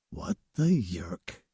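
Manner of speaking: low, slow stunned whisper. A narrator's reading. Invisible woman stunned at herself, low whisper. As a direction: whisper in disbelief. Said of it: "I whispered".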